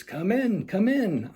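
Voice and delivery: eager welcome. Acted. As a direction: usher her in gladly. Norwell welcoming.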